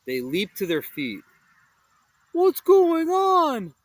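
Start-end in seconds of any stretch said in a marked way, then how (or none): 2.3-3.7 high, slow, hollow groaning; confused Shaggy with a strange hollow voice. Acted. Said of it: "Shaggy groaned".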